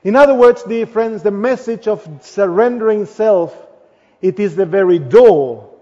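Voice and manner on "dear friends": usual speaking voice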